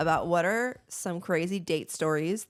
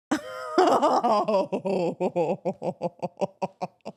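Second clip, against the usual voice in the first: hearty long laugh; 4 dB louder than usual. Real, not acted. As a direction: burst out laughing. Laughing at date story.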